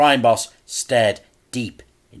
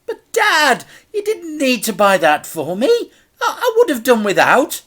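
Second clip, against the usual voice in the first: put-on much higher, louder boy voice, upset; a child protesting he didn't need it; young boy; 8 dB louder than usual. A narrator's reading. Boy tells Dad he'd have done without, upset.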